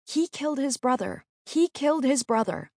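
high-pitched, restrained narrated yell; mother's yell. A narrator's reading. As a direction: voice a yelling mother's accusation, pitched well up without full volume. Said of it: "Over and over, she yelled".

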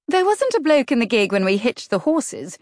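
a brash duke's daughter, voiced up high; Nora's voice. A narrator's reading. Nora voiced by the narrator.

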